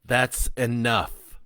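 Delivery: spat rebuke. Acted. Priest Benjamin silencing the killer, spitting the words slowly. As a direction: cut him off hard. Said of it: "Benjamin spat".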